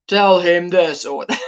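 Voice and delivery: deep, slow put-on voice. Shown as a demonstration. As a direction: drop the voice low and measured. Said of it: "I would lower my voice".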